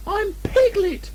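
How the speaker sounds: very high, slow squeaky protest; insist, stressing not. Acted. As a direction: Piglet protesting. Piglet insisting.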